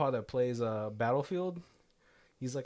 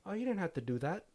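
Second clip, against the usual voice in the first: put-on a light, higher New Zealand accent; Kiwi accent; 7 semitones higher than usual. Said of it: "I'm trying to do the Kiwi accent".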